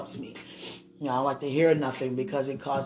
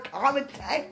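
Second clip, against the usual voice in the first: calming down; 8 semitones higher than usual. Real, not acted. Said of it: "let me stop".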